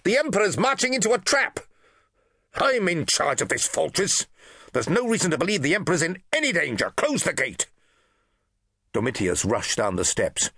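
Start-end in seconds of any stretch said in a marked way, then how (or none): 0.0-1.6 high, fast urgent shout. Acted. Legate shouting the warning from the battlements, urgent. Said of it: "shouted Domitius". 2.5-7.7 high, fast curt order; dismissive command. Acted. Praetorian officer overruling the legate, dismissive.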